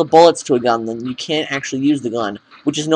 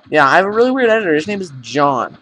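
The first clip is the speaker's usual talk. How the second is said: ordinary talk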